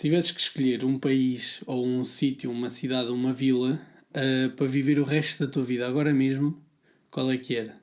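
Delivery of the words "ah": usual speaking voice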